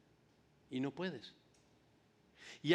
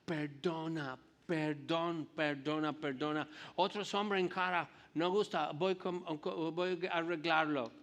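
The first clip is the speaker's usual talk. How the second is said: put-on American accent. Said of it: "y con mucho acento".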